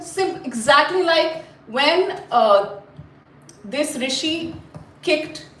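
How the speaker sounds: ordinary delivery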